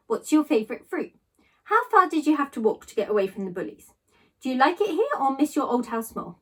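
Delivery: ordinary delivery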